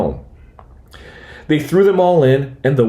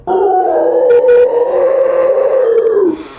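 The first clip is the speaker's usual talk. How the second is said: gasp; 5 dB louder than usual. A narrator's reading. Hears dragon's roar.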